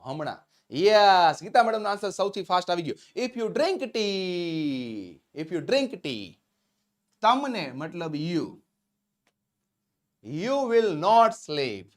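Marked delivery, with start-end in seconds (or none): 3.2-6.4 drawn-out, very slow teaching voice; slow drilling. Shown as a demonstration. Teacher repeating the translation for the class, slow drilling. 10.2-11.9 very slow, stretched model sentence; slow dictation. Shown as a demonstration. Teacher giving learners the English translation, slow dictation.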